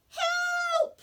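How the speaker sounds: a tiny voice shrieking, very high and loud; shouted cry for help. Acted. Baby sister calls for help from the cave, shrieking high and loud. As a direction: scream it out for rescue. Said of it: "She said her first word ever".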